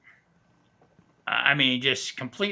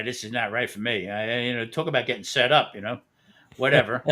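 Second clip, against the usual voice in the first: ordinary talk, nothing marked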